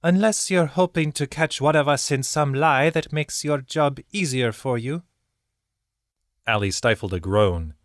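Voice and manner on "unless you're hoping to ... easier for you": high, terse and irritated; scowling birdkin woman. A narrator's reading. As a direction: challenge them, clipped and annoyed. Zael continuing, accusing.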